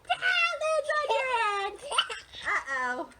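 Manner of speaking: high, loud mock dismay; squealed play-panic; playful alarm. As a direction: cry out as the bubble lands. Bubble lands on head, play alarm.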